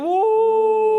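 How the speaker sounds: high, loud finish-line whoop; excited whoop. Real, not acted. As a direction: whoop as they cross the line.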